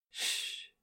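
breathy unvoiced shush; hushing. A narrator's reading. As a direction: gently hush her. Hushing her tenderly.

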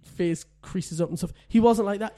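ordinary talk

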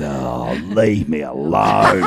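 low, slow mock groan; imitating a weary, grumbling patient; grumpy patient impression. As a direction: drag it out, fed up. Imitates Fiona brushing off physiotherapist.

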